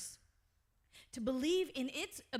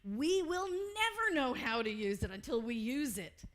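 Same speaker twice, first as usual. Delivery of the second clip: usual speaking voice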